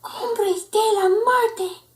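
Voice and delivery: high, slow fearful words; shivering dread. Acted. Friend warning the pup, slow shivery dread. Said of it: "with a shiverito".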